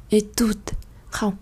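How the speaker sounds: ordinary talk, nothing marked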